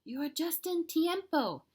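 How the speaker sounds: a lightly voiced dog greeting a friend; excited. A narrator's reading. Don Diego to Scapito.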